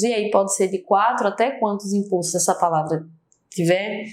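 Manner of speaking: ordinary delivery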